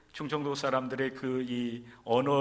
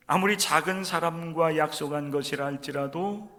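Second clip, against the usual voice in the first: ordinary talk, nothing marked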